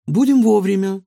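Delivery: high, loud, confident promise; emphatic high-pitched reassurance; reassuring girl. Acted. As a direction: call back assurance in a girlish pitch. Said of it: "заверила её девочка".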